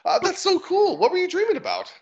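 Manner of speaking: high-pitched, delighted little halfling; excited little-character voice; eager halfling. Acted. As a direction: ask with wide-open curiosity, voice way up high. Plays the halfling.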